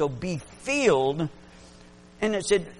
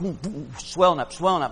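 ordinary delivery

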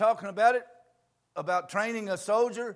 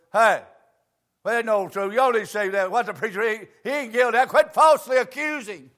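loud, indignant defence of the pastor; indignant church folk; 6 semitones higher, 8 dB louder than usual. Retold or imitated. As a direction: stick up for the preacher, raising the voice. Church folk defending their preacher.

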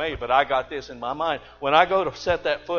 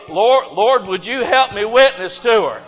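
pleading prayer; 6 semitones higher, 10 dB louder than usual. Retold or imitated. Preacher voicing his own prayer.

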